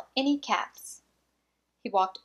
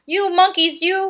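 higher and louder scolding; scolding peddler; 8 semitones higher, 8 dB louder than usual. Acted. Peddler scolding the monkeys up in the tree, louder. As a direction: wag a finger with your voice. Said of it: "he said".

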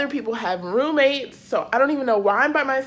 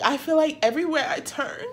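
ordinary talk

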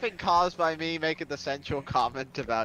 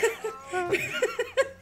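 hysterical laugh. Real, not acted. Can't stop laughing.

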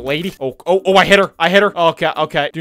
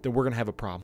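soft, low hushed explaining; secretive, lowered voice; trying to whisper; 5 semitones lower, 7 dB quieter than usual. Real, not acted. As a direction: talk quietly near the tigers. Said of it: "why I was trying to whisper".

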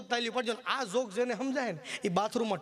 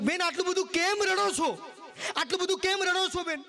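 concerned saint; 7 semitones higher than usual. Acted. Saint asking crying woman.

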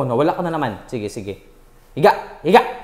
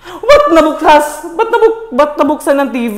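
alarmed; 7 semitones higher, 4 dB louder than usual. Real, not acted. Alarmed TV turned on.

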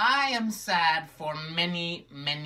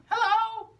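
much higher, louder puppet greeting; squeaky puppet hello; 5 dB louder than usual. Acted. Sister puppet bursts in with a high cheery hello.

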